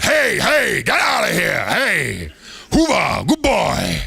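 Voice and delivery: put-on shouted high Boston accent, like a talking seal; seal mimicking Boston accent. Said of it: "mimicked his keeper, George, even with his thick Bostonian accent".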